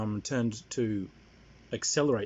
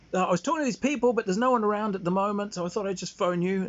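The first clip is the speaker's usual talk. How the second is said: high, quick, raised voice; Dad on the phone; 10 semitones higher, 5 dB louder, faster than usual. Retold or imitated. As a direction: imitate an oblivious dad chattering down the phone. Acting out Dad's phone call.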